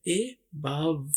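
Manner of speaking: ए बाव said very slowly, split into two parts; syllable by syllable. Shown as a demonstration. Teacher to students, showing how to say above, slowly split. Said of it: "इसमें ए को इलादा से बोला जाता है".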